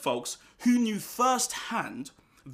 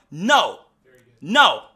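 loud, punched double no; booming emphatic denial; 3 semitones higher, 12 dB louder than usual. Acted. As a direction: slam down a firm no. Emphatic answer in sermon.

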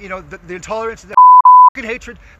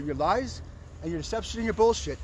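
ordinary talk, nothing marked

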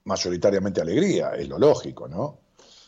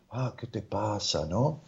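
softer and slower, hammed-up concerned therapist; mock melodramatic therapist; 9 dB quieter, slower than usual. Psychologist mocks a dramatic therapist to the patient, hushed and slow. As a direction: play an overly sympathetic therapist, softly and slowly. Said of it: "esa es la terapia dramática".

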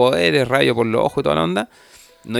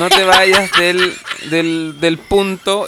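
slow, drawn-out, louder insistence; slow emphatic plea; 5 semitones higher, 4 dB louder, much slower than usual. Real, not acted. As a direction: stretch each word to make the point. Stressing not to stray. (insistiendo lento)

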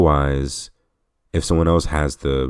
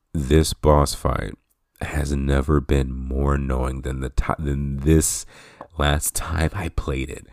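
ordinary delivery

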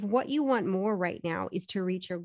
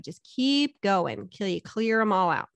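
ordinary delivery

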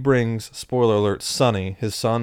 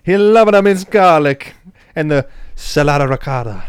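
hammed-up Italian accent, louder; mock Italian; 4 semitones higher, 8 dB louder than usual. Hosts riffing on Godfather, mock Italian accent. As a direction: play a swaggering Italian godfather type.

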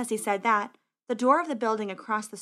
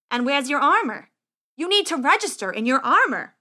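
loud, higher-pitched official demand; brusque scribe; 4 semitones higher, 7 dB louder than usual. Acted. Scribe questioning squire who came without armor, brusque and loud. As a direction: challenge the applicant loudly.

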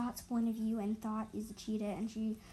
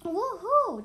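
loud high whoop; Daisy whoops to Nova from the hilltop, loud; yell; 9 semitones higher, 9 dB louder than usual. Acted. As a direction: shout for joy from the hill. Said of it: "Daisy yelled from the hill".

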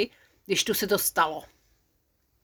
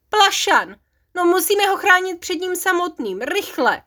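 a loud, higher urgent call; 9 semitones higher, 4 dB louder than usual. Acted. The dwarves shout to each other to chase Plašan. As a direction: raise the alarm, hurry them on. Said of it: "Zvolali žifulíci".

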